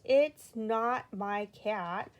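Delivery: slow, loud exasperated insistence; loud and slow, rolling his eyes. Acted. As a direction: spell it out, fed up. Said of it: "rolling his eyes".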